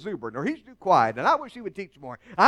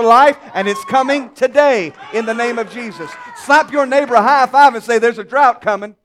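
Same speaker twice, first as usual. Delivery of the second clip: rising, shouted preaching that builds to a roar; preaching at full force; 3 semitones higher, 7 dB louder than usual. Acted. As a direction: build the congregation up, louder each line.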